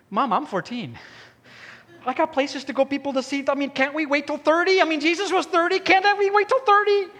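put-on teenage son pleading with his mother to wait; whiny teen bargaining.

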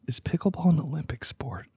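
whispered question to himself; whispering. Real, not acted. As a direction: whisper it while searching. Asks himself while looking it up.

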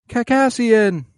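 high, eager squeal of a name; a little fan overjoyed to see his hero; excited kid voice. Retold or imitated. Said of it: "he's just like".

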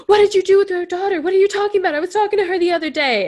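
a husband lost in time, suddenly frantic; confused distress. Retold or imitated. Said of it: "then he's like".

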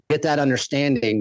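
ordinary talk, nothing marked

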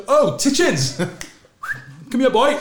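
loud, high call across the pitch; calling a rival player over, loud and high. Retold or imitated. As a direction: shout him over, high and loud, with a laugh.

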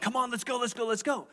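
high, pushy coach chant; pep rally push. Shown as a demonstration. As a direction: fire the church up like a coach. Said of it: "My style leans more towards challenge and admonishment".

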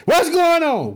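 high, loud burst of joy; happy excited. Acted. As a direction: ring out like thrilled good news. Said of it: "Naomi got happy".

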